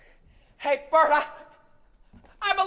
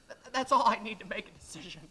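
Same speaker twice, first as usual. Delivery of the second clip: breathy pleading whisper; barely voiced, begging; desperate whisper; 3 semitones lower, 9 dB quieter than usual. Man begs the angel to see his wife, whispering.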